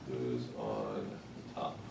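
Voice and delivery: low, drawn-out Who's on top; slow and low, like a comedy setup; comedy-bit delivery. Pretend. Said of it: "Wasn't that an Abbott and Costello act?".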